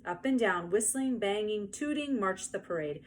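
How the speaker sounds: ordinary delivery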